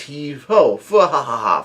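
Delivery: mock chant into spoken laughter; imitated giant's laugh. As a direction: lightly voice a giant's heave-ho and guffaw. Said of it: "this big ass giant comes out".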